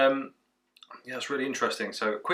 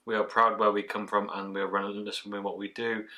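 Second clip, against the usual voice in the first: ordinary delivery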